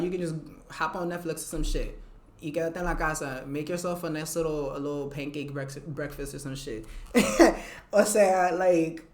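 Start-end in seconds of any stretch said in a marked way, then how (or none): none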